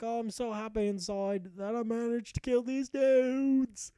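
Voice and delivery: mock-emotional, high and drawn out; teary-eyed, high-pitched. Hero overjoyed at killing these dudes, high mock-teary voice. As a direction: squeal it slowly, pretending to well up. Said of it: "He got all teary-eyed and high-pitched".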